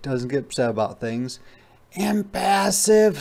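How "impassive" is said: high, slow, drawn-out sloth voice; unhurried sloth. Acted. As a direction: talk like a calm sloth in no hurry, much higher. Sloth voiced in read story.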